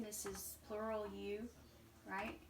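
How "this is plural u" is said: ordinary talk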